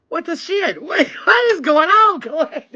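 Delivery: high-pitched, laughing bewilderment; real stunned outburst; astonished disbelief. Real, not acted. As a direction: exclaim high, with a laugh breaking in. Astonished at what keeps happening.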